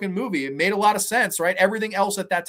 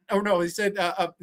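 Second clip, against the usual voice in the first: ordinary talk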